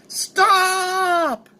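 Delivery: long, high-pitched desperate cry; drawn-out high yell, acted; acted scream. A narrator's reading. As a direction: scream it high and hold the word. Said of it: "collapses and screams".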